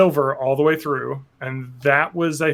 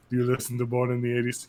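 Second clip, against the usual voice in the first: put-on lower, softer, quick accented murmur; lightly put-on accent of a menacing killer; Anton Chigurh accent; 3 semitones lower, 7 dB quieter, faster than usual. Killer at a listener's door, lightly put-on accent. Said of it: "He has that accent, too".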